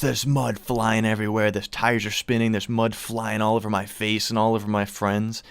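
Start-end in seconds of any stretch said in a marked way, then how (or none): none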